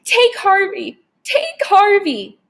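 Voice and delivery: high, slow wailing offer of his friend; sobbing frantic plea. A narrator's reading. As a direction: Wendell sobs to the vampire, wailing high. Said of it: "he sobbed".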